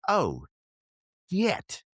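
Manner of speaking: oh no, it happened; stunned oh shit; shocked realization. Retold or imitated. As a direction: voice jumping up on shit. Realizing prediction came true.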